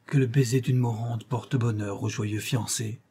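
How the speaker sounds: ordinary delivery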